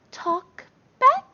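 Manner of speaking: put-on very high, loud little-girl question; puzzled toddler. Toddler daughter puzzled by her parents, high squeaky question.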